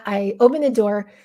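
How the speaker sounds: ordinary talk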